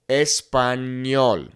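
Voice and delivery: "Español" slowly with an N-Y sound; slow clear demo; Spanish ñ. Shown as a demonstration. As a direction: draw out "Español", ñ as "ny". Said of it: "N Y sound".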